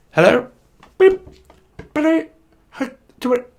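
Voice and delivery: high, loud broken-up voice; crackly drive-through customer. Pretend. Pretend customer ordering at McDonald's, high choppy voice. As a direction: squawk it in broken bursts. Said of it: "going up to a McDonald's".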